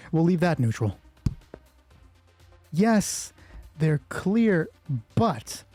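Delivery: ordinary delivery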